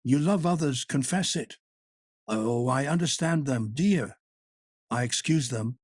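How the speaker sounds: lightly voiced woman coaxing her lover. A narrator's reading. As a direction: win him back sweetly, half teasing. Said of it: "more coaxing than an amorous kitten".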